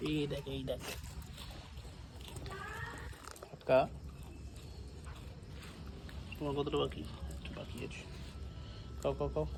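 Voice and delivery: hushed, gentle coaxing; soft talking to a dog. Real, not acted.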